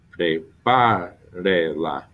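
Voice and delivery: Prepárela very slowly, stressing PÁ; stress on third-last syllable. Shown as a demonstration. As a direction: stretch Prepárela out, hitting the PÁ. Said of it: "they're gonna be three back every time".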